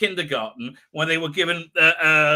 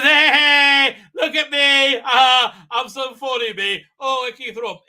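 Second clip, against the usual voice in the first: loud, high, drawn-out mock bragging; mock show-off; 7 semitones higher, 10 dB louder, slower than usual. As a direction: crow for attention, wildly hammed up. Mocking the doctor showing off.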